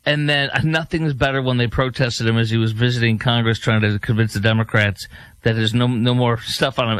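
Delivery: ordinary talk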